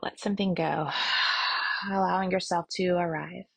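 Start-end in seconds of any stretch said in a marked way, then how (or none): 0.9-1.9 long open-mouthed exhale; audible sigh, letting it all go. Shown as a demonstration. Guide showing listeners how to exhale, sighing out. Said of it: "open up your mouth side out".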